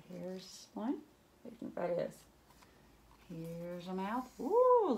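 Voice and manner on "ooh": delighted ooh. Real, not acted. Excited at picture.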